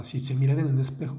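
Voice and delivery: usual speaking voice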